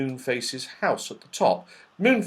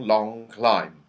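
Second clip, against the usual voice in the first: usual speaking voice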